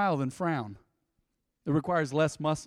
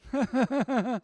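forced, higher-pitched staccato laugh; fake laugh; 7 semitones higher than usual. Shown as a demonstration. Preacher showing how to laugh when struggling, forced laugh. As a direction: push out an obviously fake laugh. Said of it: "you gotta do a fake laugh".

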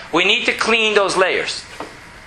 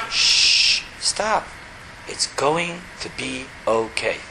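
lower, soft and calming; soothing hush; 6 semitones lower, 6 dB quieter, slower than usual. Acted. Calming his own panicking mind, soft and hushed. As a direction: hush the panic gently, it's fine.

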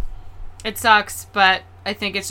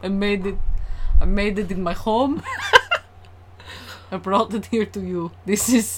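caricatured Russian peddling home brew; deliberate, hammed-up fake Russian; badly faked Russian accent; mock Russian accent. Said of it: "this is an offensive caricature".

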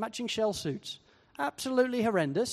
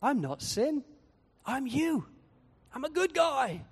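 higher, sly and sweet; sin's sly voice; 6 semitones higher than usual. Retold or imitated. Sin flattering the believer, sly and sweet. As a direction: sweet-talk like a con man. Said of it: "It deceives us into thinking".

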